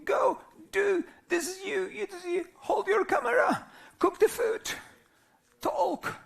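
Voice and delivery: mock a bossy system driving you on; mocking the system's high barked commands; system giving orders. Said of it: "because the system says".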